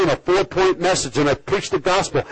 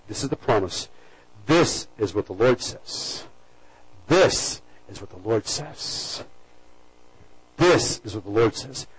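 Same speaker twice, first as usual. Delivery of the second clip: hushed proclamation; 8 semitones lower, 5 dB quieter, slower than usual. Acted. Pastor repeating God's promise to the congregation, hushed and slow. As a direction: lower and softer, slow, solemn.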